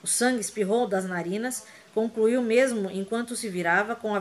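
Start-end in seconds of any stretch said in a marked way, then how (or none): none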